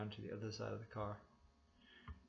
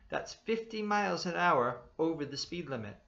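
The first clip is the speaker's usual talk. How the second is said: raised, higher cop voice; loud stern cop; 7 semitones higher, 8 dB louder than usual. A narrator's reading. Policeman scolding the driver, loud and stern. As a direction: scold him loud and high. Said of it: "he raised his voice so loud".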